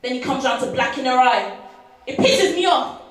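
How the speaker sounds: loud, high and fuming; angry outburst. Acted. Woman fuming at a violent boyfriend, angry outburst. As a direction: let the anger burst out, rising in pitch. Angry at men who hit women.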